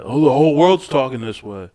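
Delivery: high, loud mocking whine. Mocking naive online crowd.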